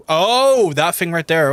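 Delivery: loud, high "oh" of discovery; real surprise as it clicks; sudden realisation. Real, not acted. Suddenly spots the windmill he sought.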